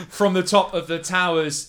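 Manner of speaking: ordinary delivery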